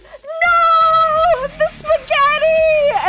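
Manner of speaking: long, high wail at full volume; re-enacted scream. Streamer re-enacting dropping spaghetti all over the ground, melodramatic scream. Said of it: "screamed at the top of my lungs".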